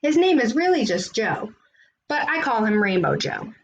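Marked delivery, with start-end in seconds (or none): none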